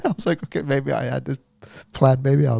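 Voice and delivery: normal talking voice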